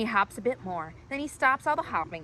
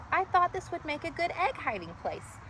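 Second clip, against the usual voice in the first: sad little voice; 8 semitones higher than usual. Acted. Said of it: "A sad little voice from an echoey space".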